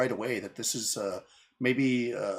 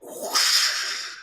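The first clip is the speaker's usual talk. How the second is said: whoosh sound effect; 7 dB louder than usual. Retold or imitated. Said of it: "it just goes".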